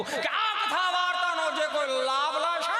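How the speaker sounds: high, slow preaching voice breaking into a laugh; mock preacher. Mocking the preacher's promise to the crowd, slow and high. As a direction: stretch out the promise like a pompous priest. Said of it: "ત્યાં બોલતા હતા".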